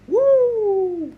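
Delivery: a high, happy cheer; excited whoop. Real, not acted. Switching camera modes for viewers, a high happy cheer.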